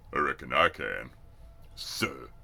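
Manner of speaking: slow low reckon; big drawling recruit. A narrator's reading. Tall recruit accepts the sergeant's challenge, low country voice.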